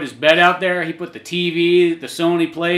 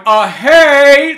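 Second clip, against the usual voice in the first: high, loud shout across the room; mock call to the projector; calling out hey; 11 semitones higher, 11 dB louder than usual. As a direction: yell to get attention. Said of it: "a guy waving at the projector going".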